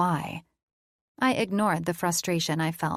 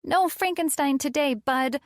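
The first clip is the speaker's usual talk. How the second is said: Colin; 8 semitones higher than usual. A narrator's reading. Colin's voice in the story.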